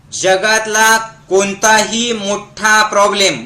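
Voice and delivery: slow, measured line for repeating; slow dictation. Shown as a demonstration. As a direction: spell it out slowly for a crowd to echo. Models line for crowd to repeat. (सावकाश, म्हणवून घेत)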